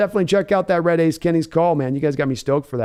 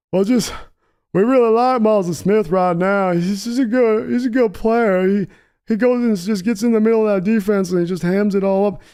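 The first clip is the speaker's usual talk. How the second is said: higher, mushy-mouthed Texan son; Stephen Jones as Hank Hill; 5 semitones higher than usual. Pretend. Son answering his father, meek Hank Hill Texan voice. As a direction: mumble it like Hank Hill, eager to please. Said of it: "Jerry's son ha-, sounds like Hank Hill".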